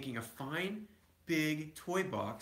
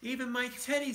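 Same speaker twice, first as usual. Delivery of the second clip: put-on higher little-girl voice, pleading; a child complaining to Papa; young cub; 8 semitones higher than usual. A narrator's reading.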